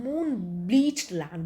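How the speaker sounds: moon-bleached land, stretched out slowly; slow and drawn out. Shown as a demonstration. Teacher slowly drawing out the word for the class. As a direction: draw out each syllable of moon-bleached land. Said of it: "Spray means where the sea".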